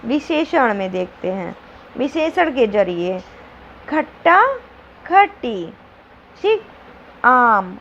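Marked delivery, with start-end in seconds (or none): none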